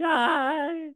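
high squeaky cheer; character voice. Acted. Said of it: "I've got a character".

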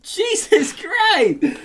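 high, slow drawn-out disbelief; stunned disbelief. Real, not acted. Friend reacting to the cow story, slow and high. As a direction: stretch it out in shock.

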